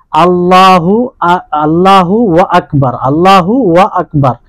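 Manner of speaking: আল্লাহু ওয়া আকবার with a wa sound slipped in between the words; wrong extra wa. Shown as a demonstration. Teacher showing students a common error, an inserted wa sound. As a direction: demonstrate the mistake of adding ওয়া before আকবার. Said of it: "মানে ওয়াও মিলিয়ে দেয়".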